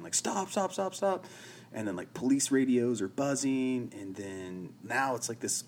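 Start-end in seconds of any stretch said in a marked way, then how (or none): 0.1-1.2 high-pitched yell at normal volume; high, repeated pretend shouts; mock shouting. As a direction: imitate a crowd shouting, held back. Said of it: "they start shouting".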